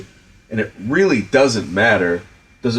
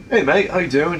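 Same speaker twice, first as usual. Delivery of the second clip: higher-pitched mock British greeting; Clive's British accent; 8 semitones higher than usual. Director imitating actor's Skype hello, British accent. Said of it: "I don't wanna do a bad Clive impersonation".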